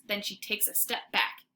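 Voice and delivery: ordinary delivery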